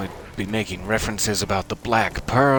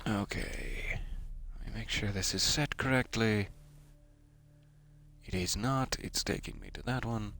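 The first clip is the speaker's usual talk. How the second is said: half-whispered mutter; 10 dB quieter than usual. Real, not acted. Muttering while setting map marker.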